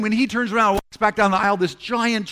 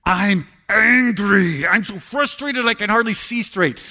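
mock angry prayer; 4 dB louder, slower than usual. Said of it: "I'm a lot louder than that in person".

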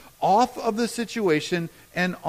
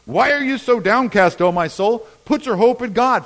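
loud, raised psalm declaration; shouted prayer; 4 semitones higher, 7 dB louder than usual. Acted. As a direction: call the psalm out to your own soul. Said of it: "that I have to shout it".

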